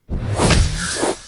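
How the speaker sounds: an unvoiced, airy chuckle; breathy dark chuckle. Being chuckles before cutting the dreamer's soul, airy. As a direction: laugh under your breath, pleased with yourself.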